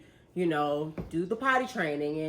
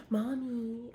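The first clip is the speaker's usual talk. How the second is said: put-on high, soft little-kid Mommy; sing-song it like a small child; a hint of a sweet little daughter; little girl voice; 6 semitones higher, 6 dB quieter than usual.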